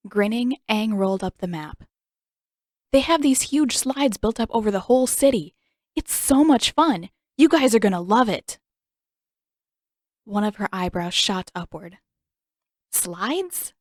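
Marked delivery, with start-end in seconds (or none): 2.9-8.6 higher, louder gushing; boy gushing about city slides; excited raving. Acted. 12.9-13.7 skeptical. Acted. Eyebrow raised, skeptical.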